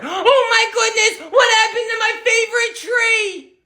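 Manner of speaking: shocked mom. Acted. Mom discovering her wrecked tree, high shocked cry. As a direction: wail over your ruined tree.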